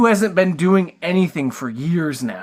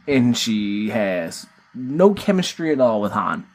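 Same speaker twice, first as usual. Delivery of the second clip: normal talking voice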